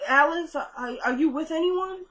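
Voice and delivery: high, hesitant question; wary call through a closed door, lightly acted; retold scared. Kelly asking who is outside her door, scared. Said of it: "You know, she's scared".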